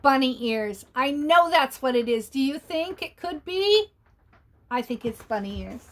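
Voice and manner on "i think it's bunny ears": ordinary delivery, nothing marked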